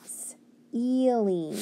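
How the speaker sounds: long hiss, then drawn-out "ealing"; sounded out. Shown as a demonstration. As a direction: split "s-" off from "ealing". Teacher sounding out a word.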